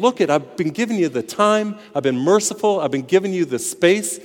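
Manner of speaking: God warning sinners, rising with urgency. Acted. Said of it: "God is saying".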